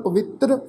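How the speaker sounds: ਪਵਿੱਤਰ with t and r joined in one sound; blended pronunciation. Shown as a demonstration. Teacher showing the half rara, blended tr in ਪਵਿੱਤਰ. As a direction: blend the final tr of ਪਵਿੱਤਰ together. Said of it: "ਪਾਠ ਉਚਾਰਨ ਕਰਾਂਗੇ ਮਿਲਵੀ tone ਨਾਲ".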